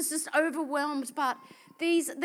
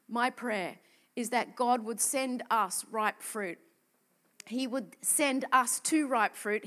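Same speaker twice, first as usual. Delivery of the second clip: prayerful; 3 semitones lower, slower than usual. Acted. Slow prayer tone.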